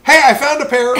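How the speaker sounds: loud, high imitated woman's shout; calling out. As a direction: holler good news across the room. Woman arrives calling out good news.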